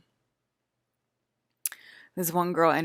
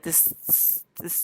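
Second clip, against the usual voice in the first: whisper; 12 dB quieter than usual. Real, not acted. Describing tiny delicate detail.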